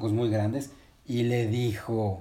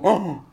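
loud, higher "ah"; acted storybook shock; startled gasp; 6 semitones higher, 8 dB louder than usual. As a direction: gasp sharply at what you see. Resbalardilla gasps at the caterpillar.

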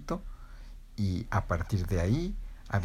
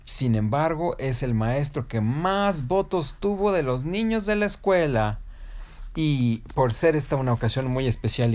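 slow, emphatic, raised ceremony voice; award announcer; 4 semitones higher, slower than usual. Acted. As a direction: stretch each big reveal for the audience. Award announcer continues speech.

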